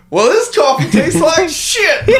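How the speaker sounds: giggling as you retell the line; slow, high, laughing quote; laughing through quote. Retold or imitated. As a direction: quote squeezed out between laughs. Retelling a funny movie line.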